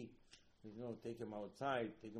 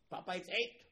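mock son's reply; 8 semitones higher, 8 dB louder than usual. Son corrects father's watch.

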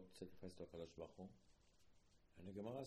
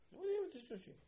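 mock old man, pitched way up; grandfather scolding; 5 dB louder than usual. Grandfather scolding grandson about sushi, high mock voice. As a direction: squeak out the disbelief.

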